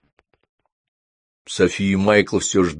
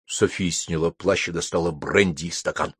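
ordinary delivery, nothing marked

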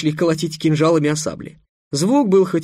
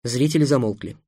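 ordinary delivery

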